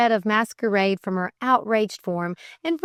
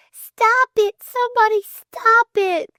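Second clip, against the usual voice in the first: high, loud frantic plea; desperate high-pitched appeal for help; begging cry; 13 semitones higher, 6 dB louder than usual. A narrator's reading. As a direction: beg at the top of the voice. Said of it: "she begged".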